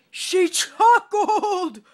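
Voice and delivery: very loud, high, slow wail; king wails at her chuckling. Acted. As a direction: howl it through sobs. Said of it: "wailed the king".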